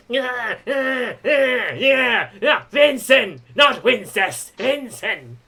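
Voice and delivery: high wailing whine, strung-out cries; protesting rival wrestler. Acted. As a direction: wail in frustration as the mocked opponent. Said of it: "And Vince is like".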